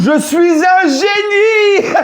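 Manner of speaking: booming genie voice, high and drawn out; hammed-up cartoon genie proclamation; Aladdin genie. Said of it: "C'est le génie dans Aladdin".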